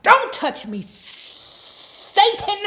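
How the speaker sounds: high, loud cry of don't touch me; mother's shout. Retold or imitated. Mother shouting at her son who pushed her down, loud and high. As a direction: yell like a horrified mother. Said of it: "Mama was like".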